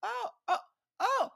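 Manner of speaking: imitated slow-dawning surprise, very high; mock puzzlement building to a loud shriek; delayed reaction. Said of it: "her reaction was more late than that".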